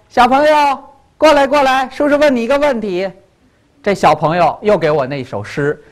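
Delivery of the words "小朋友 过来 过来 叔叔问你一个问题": coaxing uncle. Acted. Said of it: "我说".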